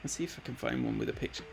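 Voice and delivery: ordinary delivery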